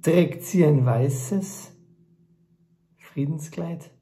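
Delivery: measured spoken-word recital with long pauses between phrases; poem recitation. Acted. Author performing his text about truth and peace, poem recitation.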